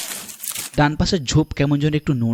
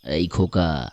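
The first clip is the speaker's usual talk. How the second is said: deep, gentle questioning; concerned stranger; 9 semitones lower, slower than usual. Acted. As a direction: ask a child kindly in a low voice. Stranger asks crying boy. (নিচু গলায় কোমল জিজ্ঞাসা)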